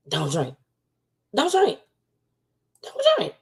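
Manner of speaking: escalating squeaky plea; rising warning. Real, not acted. Warning viewers.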